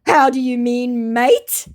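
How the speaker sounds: high, loud put-on voice; mock Aussie scammer. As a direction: play innocent. Scammer's quoted reply.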